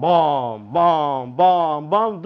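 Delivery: carpet beat thuds. Retold or imitated. Carpet beating sounds imitated.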